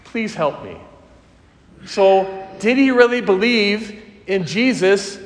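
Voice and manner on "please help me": soft, lower, half-hearted please; a flat polite request to the disciples; limp request. Retold or imitated. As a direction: ask without any urgency.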